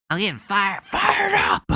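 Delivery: squeaky mock rally shout; fired-up Ted Cruz. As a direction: ham it up like a politician at a rally. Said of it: "I've never heard Ted Cruz get so fired up".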